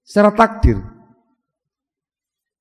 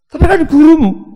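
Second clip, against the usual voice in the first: much higher, louder protest; mock objection; 11 semitones higher, 6 dB louder than usual. Imagined listener objecting he is your teacher, high mock protest. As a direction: throw in the objector's complaint.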